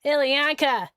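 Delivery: ordinary delivery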